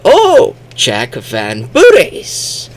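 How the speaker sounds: very high "Oh", then slow name; imitated sudden understanding. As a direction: act out a mock dawning realisation, dragging the name. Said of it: "And they go".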